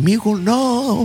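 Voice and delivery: high, slow mock sob of a crying mother; imitating mom crying. Mom crying over the weed, mock sobbing. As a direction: wail it like a heartbroken mom, hammed up. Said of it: "And she cried".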